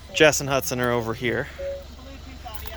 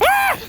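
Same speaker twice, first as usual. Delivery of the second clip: mock scream; 4 dB louder than usual. Fake scare at goblin.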